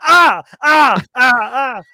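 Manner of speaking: high, loud hot-food gasping; panting to cool scalding pasta; burnt mouth gasps. Retold or imitated. Showing how hot pasta burns the mouth, high gasps. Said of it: "So you're like".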